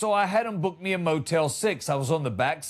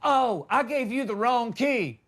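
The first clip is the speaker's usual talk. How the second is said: lightly voiced manager, raised pitch; mock a manager realizing his slip, a bit higher; motel manager; 5 semitones higher than usual. Manager admitting a key mix-up, higher mock realization. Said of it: "He's like".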